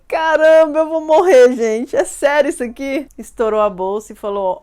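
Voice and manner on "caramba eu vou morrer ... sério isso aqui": loud, high alarmed exclaiming; panicked. Retold or imitated. Mother in labour reacting to the doctor, loud and alarmed. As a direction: blurt it out in shock.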